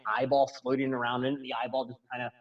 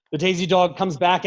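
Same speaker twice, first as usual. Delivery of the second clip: ordinary talk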